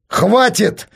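high, loud shout of "enough"; father snaps and yells at his son. A narrator's reading. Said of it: "закричал отец". (громкий крик)

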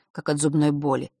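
ordinary delivery

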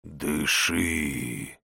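low rasping shadow command; creeping nightmare whisper. Acted. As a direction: rasp it out like a dark shade. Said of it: "вновь хрип тени".

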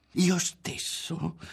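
high, drawn-out, odd-sounding voice; strange timbre. A narrator's reading. Berto continuing his secret, in a strange voice. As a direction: stretch it slowly in an eerie tone. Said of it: "con un timbro di voce strano".

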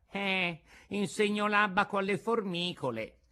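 put-on high-pitched old carpenter; Antonio, the carpenter. A narrator's reading. Carpenter answers his visitor from the floor, high character voice.

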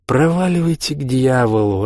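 drawled, lightly contemptuous send-off; dismissive curse. A narrator's reading. Drunk man dismisses his brother-in-law, contemptuous. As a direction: brush him off with a curse.

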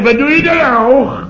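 high mock wife calling through a door; wife calling through the bathroom door, high voiced. Doemie quoted at door.

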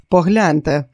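usual speaking voice

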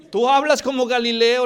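loud, high, laughing jeer; mock him with a laugh; laughing taunt. Bystanders laughing at Peter's northern accent, loud jeer.

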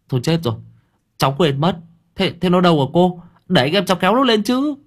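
high-pitched worry, rising as it goes; alarmed realisation. A narrator's reading. Nam panics about forgotten girl on bike.

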